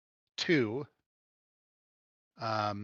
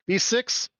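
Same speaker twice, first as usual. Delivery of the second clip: higher, louder, brisk order to a droid; barmaid giving orders; 7 semitones higher, 7 dB louder than usual. Acted. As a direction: bark a quick order to the droid. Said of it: "she turns to the droid and she says".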